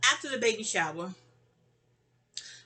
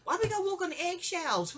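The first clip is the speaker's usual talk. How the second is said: high-pitched exasperated question; mock outrage at tiptoeing around; indignant complaint; 10 semitones higher than usual. Retelling roommates' tiptoeing, mock indignant high.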